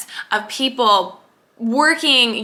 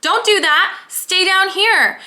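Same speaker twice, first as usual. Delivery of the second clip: higher, slow warning inner voice; wounded ego pleading, higher and slow; mock ego voice; 8 semitones higher, slower than usual. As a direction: voice the ego holding you back. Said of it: "ego wounded conditioned voice that's saying".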